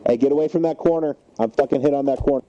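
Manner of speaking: loud urgent warning; shouting orders over battle. Real, not acted. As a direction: shout it out after being hit. Just hit, warning team.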